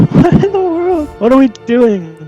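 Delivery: high, stunned disbelief; can't believe what you're reading; incredulous. Real, not acted. Shocked reaction to review.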